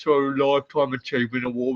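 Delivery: rough gravelly growl. Acted. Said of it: "you get me back in my gravel voice".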